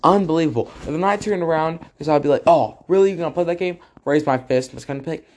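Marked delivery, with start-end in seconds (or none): 2.5-3.8 mock confrontation. Squaring up to fight back.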